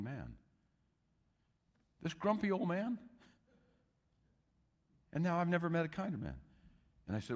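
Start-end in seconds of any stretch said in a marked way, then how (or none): 2.0-3.0 put-on high, rising, amazed at the words grumpy old man; marvelling disbelief. Shown as a demonstration. Preacher marvels to the congregation at the grumpy man, amazed. 5.1-6.3 raised, wondering tone on never met a kinder man; awed sincerity. Shown as a demonstration. Preacher tells the congregation of the changed man, awed.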